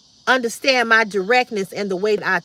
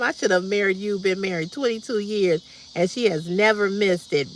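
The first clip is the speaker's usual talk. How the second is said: normal talking voice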